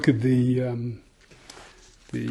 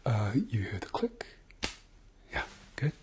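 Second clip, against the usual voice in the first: whispering; 2 semitones lower, 9 dB quieter than usual. Acted. ASMR doctor exam roleplay.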